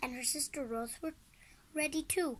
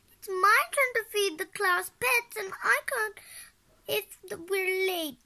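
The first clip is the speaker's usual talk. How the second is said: put-on high, loud childlike complaint; wailing; 8 semitones higher, 7 dB louder than usual. A narrator's reading. Ross worried about feeding the class pets, wailing. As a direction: wail it like an upset kid. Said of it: "Ross wailed".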